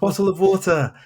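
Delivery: high-pitched pupil's request, carefully said; "bottle of water" high, with the t's sounded; imitated students. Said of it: "students at my school".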